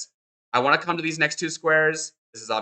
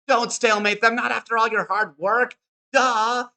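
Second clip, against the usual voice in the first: mock exasperation; 8 semitones higher than usual. Mock plea against a terrible mistake.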